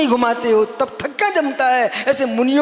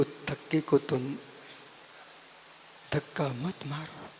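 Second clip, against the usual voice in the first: low, soft, grave appeal; hushed preaching; 5 semitones lower, 6 dB quieter than usual. Real, not acted. Monk pleading against ill thoughts of monks, hushed and low. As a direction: plead quietly, leaving long pauses.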